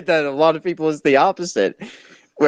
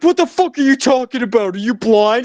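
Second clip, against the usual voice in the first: indignant fan; 10 semitones higher than usual. Retold or imitated. Fans at party snapping back at wrong call, outraged. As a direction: play the furious fan shouting him down. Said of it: "everyone is just like".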